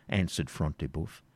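plain reading voice

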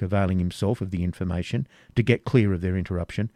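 ordinary delivery

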